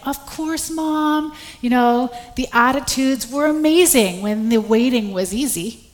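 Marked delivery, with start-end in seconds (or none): 0.1-1.3 put-on higher, sweet eager-to-please kid voice; cheerful obedient son. Mother imitating her son cheerfully agreeing to make his bed. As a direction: chirp it like a model child. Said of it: "mom's request, son's cheerful reply".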